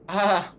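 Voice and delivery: short, loud cry of pain. Real, not acted. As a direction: cry out from burning heat. Reacting to burning hot sauce.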